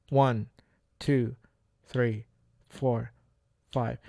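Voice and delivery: counting out the seconds, evenly spaced; one number per second, quietly fading; slow five-second count. Shown as a demonstration. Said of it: "Five seconds when you have a conversation".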